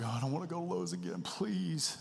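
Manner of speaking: put-on fast, whining child's plea with a pitch jump on please; hammed-up kid begging not to go to Lowe's again; whiny kids. Pastor imitates his kids dreading another trip, whining.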